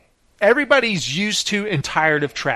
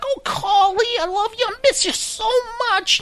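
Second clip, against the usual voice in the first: much higher, sugary gushing mother; doting mom voice. Retold or imitated. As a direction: coo it like a mom who babies her son. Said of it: "she talks just like that".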